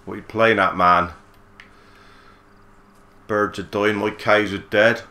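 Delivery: loud, half-hearted impression of an irate farmer; imitating Frank. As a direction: do a hammed-up cross Frank. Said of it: "Frank said".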